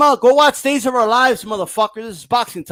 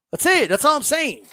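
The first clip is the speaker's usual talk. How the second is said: ordinary delivery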